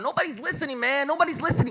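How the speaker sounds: high, strained-up plea; despairing complaint. Retold or imitated. Activist feeling unheard by the world, despairing. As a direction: raise the pitch in helpless frustration. Voices a discouraged activist's thought.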